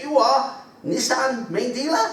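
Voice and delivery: high, slow "You are Nissan main dealer"; courier punchline. Retold or imitated. Storyteller landing the joke in the delivery man's high, slow voice.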